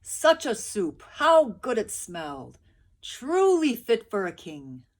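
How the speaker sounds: loud, slow awe; marveling villagers. A narrator's reading. Villagers marveling at the soup, slow and loud. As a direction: savour every word in wonder.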